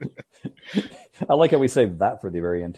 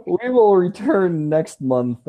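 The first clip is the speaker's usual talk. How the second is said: slow, louder sign-off announcement; announcer voice; 7 semitones higher, 4 dB louder, much slower than usual. Acted. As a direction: announce it like a radio host.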